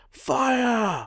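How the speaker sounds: high, drawn-out sergeant's yell, falling at the end; yelled command. A narrator's reading. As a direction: give the firing order in a high-pitched yell. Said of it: "he yells".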